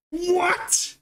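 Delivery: high, hammed-up Aussie voice; mock Australian accent. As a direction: pitch up into an exaggerated Australian. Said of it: "that Australian accent".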